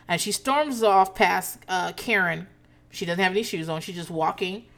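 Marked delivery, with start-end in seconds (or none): none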